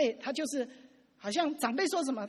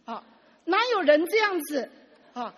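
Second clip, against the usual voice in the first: higher and louder, a fed-up complaint thrown at God; 生气地向神抱怨; 7 semitones higher, 8 dB louder than usual. Retold or imitated. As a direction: slap the Bible down and declare it, exasperated. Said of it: "他就这样很生气的这样". (气冲冲地、提高嗓门对神抱怨)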